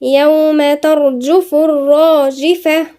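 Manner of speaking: slow, drawn-out tajweed chant; measured Quranic recitation. Shown as a demonstration. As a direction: recite it melodically with long held vowels. Recites next verse.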